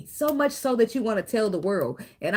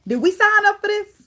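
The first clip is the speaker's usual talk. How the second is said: exasperated; 13 semitones higher, 6 dB louder than usual. Acted. Exasperated question to the Lord.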